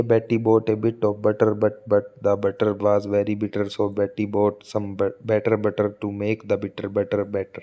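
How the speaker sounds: careful classroom read; slow tongue twister. Shown as a demonstration. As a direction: spell out every b slowly. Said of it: "बी की repetition हो रही है".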